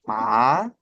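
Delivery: "Ma" with the pitch sliding up like an arrow; teacher demonstrating a rising "Ma" to students, pitch sliding upward; rising second-tone ma. Shown as a demonstration. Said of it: "Dia naik".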